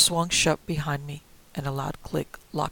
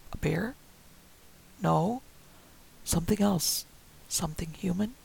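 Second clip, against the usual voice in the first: whispered, breathless question; whispering to herself in dread; fearful whisper. Acted. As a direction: dread creeping in. Narrator fearful thought.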